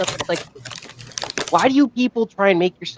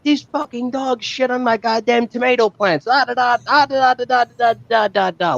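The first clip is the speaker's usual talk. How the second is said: mock Italian yelling; 9 semitones higher than usual. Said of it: "screaming Indego".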